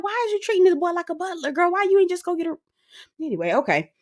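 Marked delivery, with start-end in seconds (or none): 0.0-2.6 high incredulous question. Retold or imitated. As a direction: ask it in disbelief. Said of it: "I was like".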